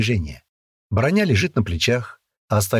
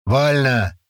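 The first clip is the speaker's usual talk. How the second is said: barked command; 4 semitones higher than usual. A narrator's reading. Sergeant gives parade command.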